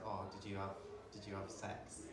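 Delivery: low, soft, hesitant asking; retold question, hushed and low; discreet question. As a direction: ask it quietly, feeling your way. Asks flatmate the obvious question.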